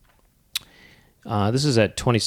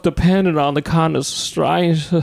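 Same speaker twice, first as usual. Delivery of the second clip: Tennessee Williams impression; 6 semitones higher than usual. Quoting the famous line as Tennessee Williams, higher pitched. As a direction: quote it like a genteel Southern playwright. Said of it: "Tennessee Williams sounds a little bit like this".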